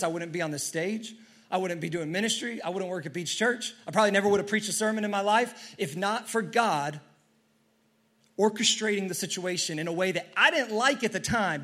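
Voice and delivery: ordinary talk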